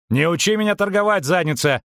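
high-pitched, indignant snap; angry outburst. A narrator's reading. Merchant rounds on the double lecturing him, angry. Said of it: "обрушился Бибервельт".

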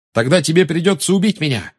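high, defiant shout back; Harry shouting back at Bellatrix. Acted. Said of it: "откликнулся Гарри".

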